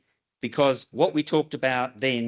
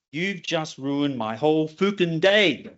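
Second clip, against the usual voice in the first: attempted Scottish accent; 4 semitones higher, slower than usual. Project manager to caller who sprang a demo, attempted Scottish accent. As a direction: mimic an exasperated Scotsman, drawn out in his accent. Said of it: "I'll try to do the accent".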